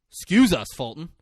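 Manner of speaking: high, loud burst on EXCUSE; "Excuse" punched high, then dropping off; mock affronted protest. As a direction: play indignant at being interrupted. Acting interrupted boy's protest.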